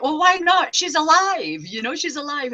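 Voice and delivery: put-on high, loud little girl protesting; young girl arguing with her mom; child's protest. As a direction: insist like an indignant seven-year-old. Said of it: "And I was like".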